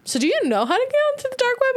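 very high, quick question; eager question. Retold or imitated. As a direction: ask it fast in a high, innocent squeak. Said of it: "And I go".

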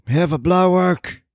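put-on high, querulous old man voice; cranky old man.

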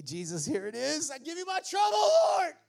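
climbing from plain prayer to a loud high cry; shouted prayer. Shown as a demonstration. Preacher praying aloud, handing trouble to the Lord with a shout. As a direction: give your trouble to the Lord, building up.

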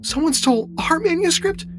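high-pitched woman's voice, aghast; horrified. A narrator's reading. Beth learns the manuscript was stolen, horrified. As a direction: ask it high, in horror. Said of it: "Beth looked horrified".

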